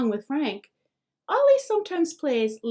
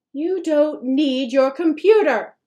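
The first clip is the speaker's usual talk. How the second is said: louder, higher furious shout; a mother yelling at her son; 5 semitones higher, 8 dB louder than usual. A narrator's reading. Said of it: "Mom yells, so furiously".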